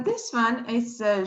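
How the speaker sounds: ordinary talk, nothing marked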